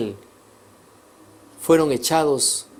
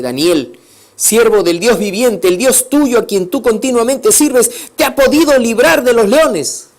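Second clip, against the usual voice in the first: loud, higher, urgent questioning; anxious loud call; 7 semitones higher, 8 dB louder than usual. Acted. Grieving king asks Daniel if God saved him, loud and anxious. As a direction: plead down into the den for an answer. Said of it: "Yo me imagino su voz estaba triste".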